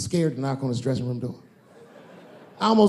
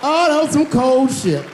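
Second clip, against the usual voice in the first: much higher, louder; outraged; 10 semitones higher, 7 dB louder than usual. Real, not acted. [name] reacts to Kevin's jab, loud and high. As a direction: yell it in mock outrage.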